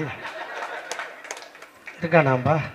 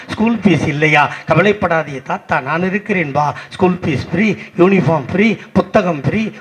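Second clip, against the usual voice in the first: rhythmic declaimed list of promises; political stage oration; 9 dB louder than usual. Acted. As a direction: declaim it like a rally speaker, question then answer. Delivers a rehearsed political monologue.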